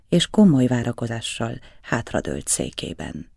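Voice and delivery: ordinary delivery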